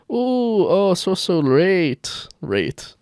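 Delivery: slow, lightly put-on fake Chinese accent, "Rate" with an r; mock Chinese accent. Reading the creep's text aloud, slow mock Chinese accent. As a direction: drag out "so late" then say "Rate". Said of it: "He's doing an accent over text".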